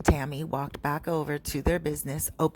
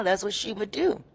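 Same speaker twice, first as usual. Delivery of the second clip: normal talking voice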